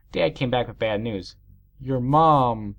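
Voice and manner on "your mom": slow, grave dad voice; somber. A narrator's reading. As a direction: break bad news slowly and gravely. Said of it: "he said somberly".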